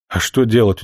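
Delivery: plain reading voice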